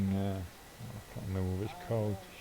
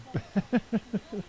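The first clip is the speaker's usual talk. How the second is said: high, rapid chuckling; high giggling fit; real laughter; 10 semitones higher than usual. Real, not acted. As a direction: crack up again mid-sentence. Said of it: "I shouldn't laugh, really".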